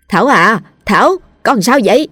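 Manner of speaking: worried calling. A narrator's reading. Frightened mother calling through a door.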